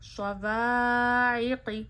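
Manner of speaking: "स्ववाइक़ि" slow, alif drawn one finger long; stretched alif madda. Shown as a demonstration. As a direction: pull the alif long, keep the ि short. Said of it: "अलिफ मद्दा करिए". ("स्ववाइक़ि" में अलिफ को खींचें)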